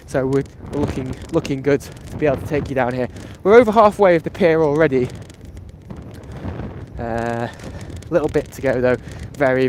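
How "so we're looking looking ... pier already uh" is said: normal talking voice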